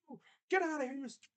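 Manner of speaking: high, far-off mock streamer yell, cut off; EDP rant. Mocking a streamer's over-the-top reaction, high mock yell. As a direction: do a wild hyped streamer outburst. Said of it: "I'm not gonna be like E D P".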